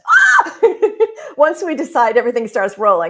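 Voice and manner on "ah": very high loud shriek breaking into laughter; excited shriek into laugh. Real, not acted. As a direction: squeal with pure excitement. Thrilled by deciding.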